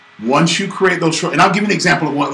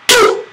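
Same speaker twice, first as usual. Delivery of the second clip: very high imitated poof; mock cartoon punch sound; punch sound effect; 4 dB louder than usual. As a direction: pop out a comic punch noise. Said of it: "he went".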